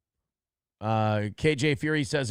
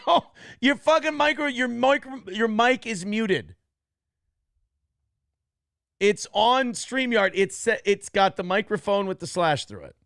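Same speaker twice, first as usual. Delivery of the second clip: exasperated yelling; 9 semitones higher, 5 dB louder than usual. Real, not acted. Host yelling at muted guest.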